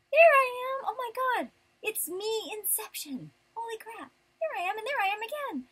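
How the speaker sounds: high-pitched mock-astonished exclamations; mock amazement. Mock amazement at seeing self in mirror.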